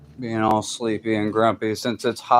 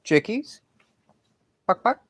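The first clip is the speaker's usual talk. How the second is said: chicken call; 11 semitones higher than usual. Real, not acted. Calling chickens at night.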